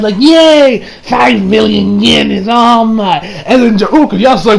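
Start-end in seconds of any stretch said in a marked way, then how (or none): 0.2-3.2 mock gleeful cheer. Shigechi cheering over the lottery ticket, hammed-up glee. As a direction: cheer like a kid who just won big. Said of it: "Shigechi's surprised".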